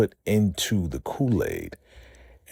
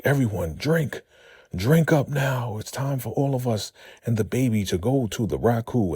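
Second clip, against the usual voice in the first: ecstatic cult command; 7 semitones higher than usual. Retold or imitated. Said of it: "She's like".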